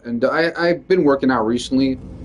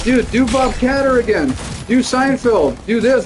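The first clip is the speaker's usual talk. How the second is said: excited request; 9 semitones higher than usual. Retold or imitated. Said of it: "They just get excited".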